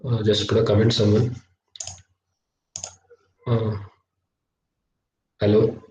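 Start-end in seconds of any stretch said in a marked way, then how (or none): none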